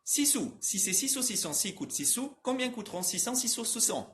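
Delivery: trava-língua ágil, sílabas encadeadas, tom mais agudo; mais rápido. Shown as a demonstration. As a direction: emende as sílabas do trava-língua num ritmo corrido. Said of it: "Eu vou falar mais rápido". (rápido e encadeado, como trava-língua)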